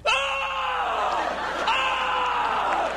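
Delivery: very high strained yells of a lifter; hammed-up weightlifter screams; mock straining grunts. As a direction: yell like a straining gym guy lifting. Gym guy straining loudly.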